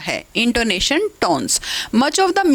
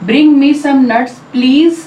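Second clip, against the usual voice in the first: slow and louder, stress on "please"; polite request; 2 semitones higher, 8 dB louder, much slower than usual. Shown as a demonstration. Teacher demonstrating a polite request, stress on please. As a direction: ask nicely, leaning on "please". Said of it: "please पे stress है, लेकिन हमने request की tone में बोला".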